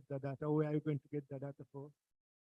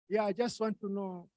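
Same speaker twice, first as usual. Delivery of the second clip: usual speaking voice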